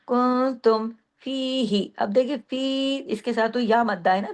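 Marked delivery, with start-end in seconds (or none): 0.1-1.9 slow कुंतुम फीही, held hum, long फी, short ही; madd and ghunna. Shown as a demonstration. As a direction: stretch फी but not ही. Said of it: "दो हरकत खींचेगी". (कुंतुम फीही, फी लंबा और ही छोटा)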